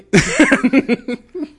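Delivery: loud rising laugh; high laugh. Real, not acted. Mocking Nick Fury's eye story, loud high laugh.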